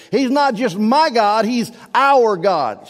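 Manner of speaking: "my" lifted, then slow "our God"; my versus our. Shown as a demonstration. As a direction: contrast "my" with a slow, heavy "our". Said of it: "So what are you emphasizing?".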